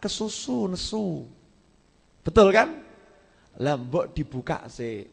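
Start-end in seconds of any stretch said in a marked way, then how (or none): none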